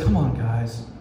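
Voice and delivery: low, sighing letdown; weary sigh. Retold or imitated. God sighing at his people, weary. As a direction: sigh it out like a tired parent. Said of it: "And God sighs and says".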